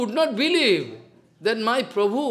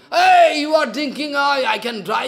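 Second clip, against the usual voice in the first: raised, louder outcry of alarm; startled protest; 6 semitones higher, 6 dB louder than usual. Retold or imitated. Sridhar cries out to Mahaprabhu, loud alarmed protest. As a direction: call out to stop him drinking.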